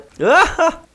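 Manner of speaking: high squeal of a laugh; high gleeful laugh. Real, not acted. Laughing after winning.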